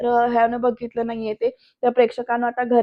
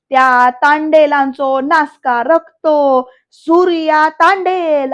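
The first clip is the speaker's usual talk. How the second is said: loud, very slow, climbing on the name; hammed-up angry reveal, each word stretched; dramatic denunciation; 3 semitones higher, 6 dB louder, slower than usual. Varad names Surya to the family, dramatic denunciation.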